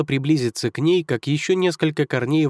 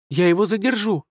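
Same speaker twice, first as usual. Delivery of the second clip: shouting; 7 semitones higher than usual. Acted. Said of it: "крикнула Алиса".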